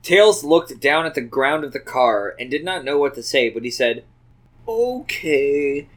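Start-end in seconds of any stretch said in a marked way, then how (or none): 4.7-5.9 understated, subdued okay; a small, downcast reply; mildly glum, held back; sad and quiet. A narrator's reading. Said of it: "said it in a sad and quiet way".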